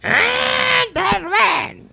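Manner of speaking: shrieking, very high demon voice calling a name; possessed shriek, high and loud; demon's shriek. Retold or imitated. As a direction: screech the name like a possessed girl. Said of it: "Sinabihan ako ng mga demonyo dito".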